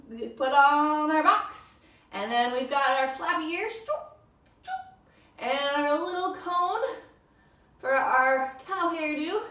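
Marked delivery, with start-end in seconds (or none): 3.7-5.5 soft popping choops, last one squeaked high; playful sound effect; mouth sound effect. Acted. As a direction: pop them on like ears. Sound effect for flappy ears.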